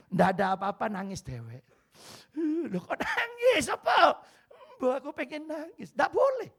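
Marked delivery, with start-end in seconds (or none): 2.7-6.5 high mock exclaim. Stressed woman asking why she cries, high mock surprise. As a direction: act out a stressed woman suddenly wanting to cry. Acting out crying person dialogue.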